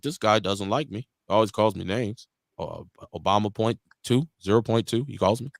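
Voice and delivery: low-pitched, measured presidential imitation; Obama impression. Joking to friends about being called names, Obama impression. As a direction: drop the voice low into a mock statesman. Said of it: "That's my best Obama".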